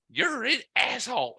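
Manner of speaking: high-pitched, indignant retort; mock outrage. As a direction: imitate her affronted insult. Retelling her outraged insult.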